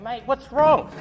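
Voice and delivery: high, loud startled question; surprised. Retold or imitated. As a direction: blurt it out, puzzled. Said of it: "I'm going".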